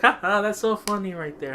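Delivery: hammed-up, high-pitched mock laugh; boss laughing it up. Former boss laughing at the strange lady, mock amusement. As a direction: imitate an amused boss with a fake ha-ha. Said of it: "my former boss is laughing it up".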